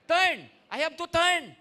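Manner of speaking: high, loud "Turn"; emphatic cry. Shown as a demonstration. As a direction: shout "Turn" as a command. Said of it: "preacher demonstrates turning". (malakas at mataas na "Turn")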